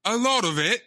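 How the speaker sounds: a Brit faking an American accent, badly; movie character's hybrid British-American accent. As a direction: put on the film's odd British-American accent. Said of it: "Your accent is way too good".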